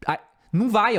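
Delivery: usual speaking voice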